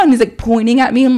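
normal talking voice

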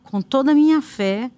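ordinary talk

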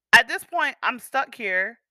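ordinary talk, nothing marked

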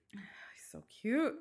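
slow breathy sigh of a whisper; swooning whisper. Real, not acted. Smitten fan whispering about her favorite singer.

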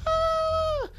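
very high, thin shriek; panicked scream. Acted. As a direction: scream like someone fleeing in panic. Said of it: "running around in a panic".

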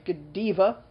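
Godiva said guh-DEE-vuh; Godiva as guh-DEE-vuh, drawn out slowly; playful pronunciation. Shown as a demonstration. Playing with brand name's pronunciations.

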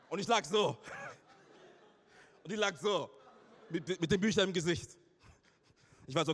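ordinary delivery

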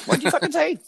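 imitating mom snapping back; mom's furious yell. Mom snaps at a harmless remark, shrieking high. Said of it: "my mom was totally like".

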